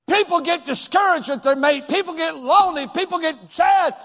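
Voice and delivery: high, loud preacher's cry; full-force preaching. Acted. Pastor urging the congregation not to quit, preaching at full force.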